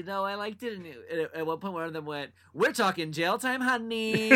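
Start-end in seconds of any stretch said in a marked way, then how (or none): 2.5-4.3 sassy mock cop. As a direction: give it sass, drawn out. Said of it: "it got a little sassy".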